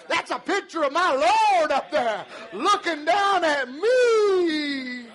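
high, ringing shouted testimony; full-force preaching. Acted. Preacher answers the scoffers with shouted, jubilant testimony. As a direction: shout it back at the doubters.

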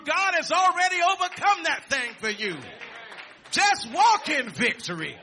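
fervent pulpit shouting; full-force preaching. Acted. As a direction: preach victory over them with all your breath.

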